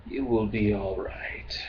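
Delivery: understated low alien rasp; lightly voiced, hushed Drakh; a hint of a gravelly whisper. A narrator's reading. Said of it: "his customary low, gravelly whisper".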